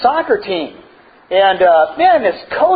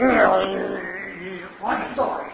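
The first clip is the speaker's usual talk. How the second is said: much softer, reluctant mumbled sorry; grudging apology; 2 semitones lower, 10 dB quieter than usual. Retold or imitated. Son apologizing to his coach, soft grudging mumble. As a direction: mumble it like a kid made to apologize.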